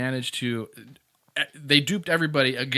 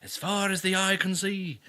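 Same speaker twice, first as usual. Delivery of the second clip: put-on higher, slow old Irishman storyteller; old Irishman accent; 7 semitones higher than usual. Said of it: "As the old Irishman stepped in".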